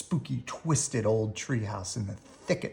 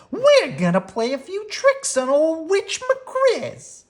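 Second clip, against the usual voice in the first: high, loud, slow bully drawl; Too Tall bully voice; 6 dB louder, slower than usual. A narrator's reading. Too Tall telling the gang his plan, high gloating voice.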